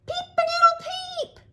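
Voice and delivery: very high, loud squeaky crow; a tiny chick trying to crow like a rooster; chick's crow. Acted. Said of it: "took a deep breath and tried again".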